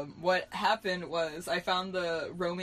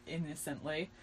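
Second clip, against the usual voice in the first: ordinary delivery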